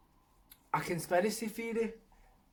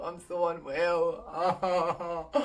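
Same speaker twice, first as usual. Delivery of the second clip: laughing; much slower than usual. Real, not acted. Laughing at own misery.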